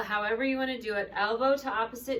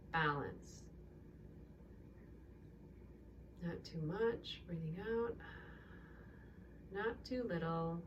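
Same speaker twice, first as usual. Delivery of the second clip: calm yoga teacher talking a class through breaths; guided breathing; 8 dB quieter than usual. Acted.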